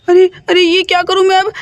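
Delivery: ordinary delivery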